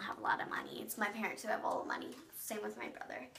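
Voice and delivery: hushed secret whisper, quick. Real, not acted. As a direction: let it slip as a quick whisper. Confiding about money, whispered aside.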